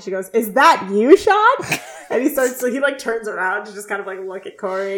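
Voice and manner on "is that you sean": higher, louder mock call from outside. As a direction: imitate a girl calling out, voice leaping up at the end. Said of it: "a girl calls out from somewhere outside".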